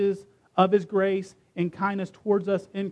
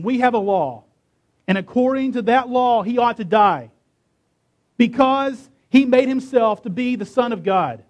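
loud, raised-pitch accusation; insistent accusation; 6 semitones higher, 6 dB louder than usual. A narrator's reading. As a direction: press the charge forcefully before the judge. Accusers answer Pilate, demanding death.

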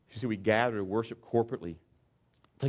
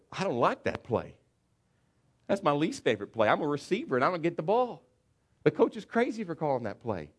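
a whiny receiver complaining, higher; 6 semitones higher than usual. Acted. Receiver griping to teammates about the coach, lightly whiny. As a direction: gripe like a receiver who never gets the ball.

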